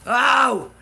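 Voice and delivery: a loud, high burst of remembering; sharp high "oh" of recall; sudden realization. Real, not acted. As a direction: blurt it as it hits you. Sudden recollection mid-story.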